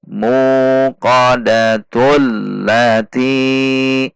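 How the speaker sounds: "muqadatul lati" recited as one linked, drawn-out phrase; slow connected recitation of "muqadatul lati"; joined reading. Shown as a demonstration. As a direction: link "muqadatul" straight into "lati", holding the doubled L. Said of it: "jika diwasal atau disambung".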